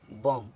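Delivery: बॉम ending on M, no B sound; silent B. Shown as a demonstration. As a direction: say बॉम with the final B left silent. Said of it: "एम के बाद आया बी silent होता है".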